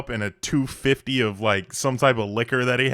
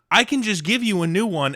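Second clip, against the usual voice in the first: mock barber; 10 semitones higher, 6 dB louder than usual. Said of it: "And she's like".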